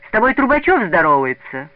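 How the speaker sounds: loud jeering schoolkids; kids shouting. A narrator's reading. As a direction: call out to the newcomer. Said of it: "закричали вокруг ребята".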